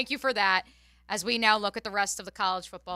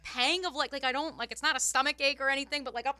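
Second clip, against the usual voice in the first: higher-pitched worried retelling; 6 semitones higher than usual. Retold or imitated. Retelling a stress cramp mid-game.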